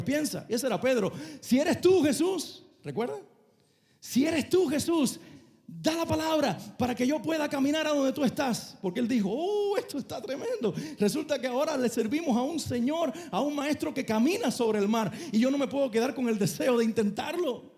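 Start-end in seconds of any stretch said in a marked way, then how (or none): 1.4-8.8 high, eager call across the water. Retold or imitated. Peter calls to Jesus from the boat, high and eager. 9.3-17.7 high, laughing excitement; delighted, bursting to try it; thrilled Peter. Retold or imitated. Peter marvels at his master walking on the sea, high and laughing.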